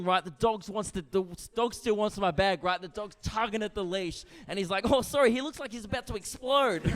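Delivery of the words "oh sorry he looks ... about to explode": high-pitched Kiwi officer; exaggerated Kiwi accent; New Zealand accent. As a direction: apologise brightly in a put-on New Zealand accent. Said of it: "Excuse the accent".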